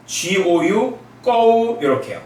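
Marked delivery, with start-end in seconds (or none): 1.2-1.8 꼬우 held high and level; first tone. Shown as a demonstration. Teacher reading gou in a high level first tone. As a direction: say 꼬우 high and flat like a Mandarin first tone. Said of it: "G의 음가도 영어랑 똑같습니다".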